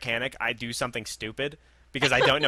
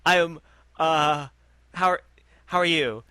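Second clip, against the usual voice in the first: halting, higher-pitched fumble for words; awkward stammering; 4 semitones higher, 5 dB louder than usual. Retold or imitated. Customer lost for words before his mechanic, awkward stammering. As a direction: re-enact being stuck, with pauses between attempts. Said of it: "I had nothing to say".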